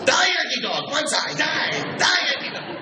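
hammed-up war-flashback shouting, very high; crazed old Japanese doctor. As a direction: scream like a doctor lost in battle. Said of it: "old Japanese doctor, with flashbacks of World War Two".

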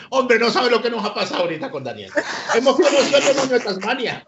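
high, amused retelling through laughter; laughing. Retold or imitated. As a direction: imitate a friend gleefully telling everyone, laughing. Amused friend retelling the incident.

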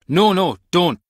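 loud, high pleading; urgent protest. Acted. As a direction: cry out to stop them. Villain protesting in a confrontation.

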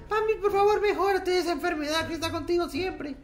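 high, soft comment-reader voice; mock pleading. Vlogger dictating fans' comment to sick friend, high mock plea. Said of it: "a ella le ponen".

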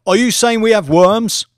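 high, loud outburst of disbelief; shocked disbelief. Acted. Trader to the professor, shocked and loud. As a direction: blurt it out, appalled.

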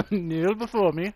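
echoing it through a laugh. Pretend. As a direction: laugh the command back. Echoes villain line, laughing.

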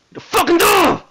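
high, loud furious shout; angry outburst. Acted. President yelling about the door, furious outburst. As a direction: explode with anger, pitch shooting up. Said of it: "Hey, calm down".

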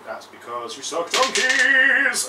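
loud, high, drawn-out jeer; slow, rising mock yell; shouted taunt. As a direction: stretch the insult out at full voice. Taunting opponent over the score.

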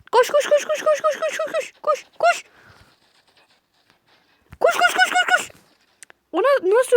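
high, loud rapid chant of run; panicked repeated shout; frantic urging. Real, not acted. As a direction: push them to flee.

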